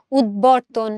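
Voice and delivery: উদ্বর্তন as "ud-borton", b clearly said; b sounded. Shown as a demonstration. Teacher showing what we say, b sounded. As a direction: make the b in উদ্বর্তন heard. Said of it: "বয়ের উচ্চারণটা পাচ্ছি".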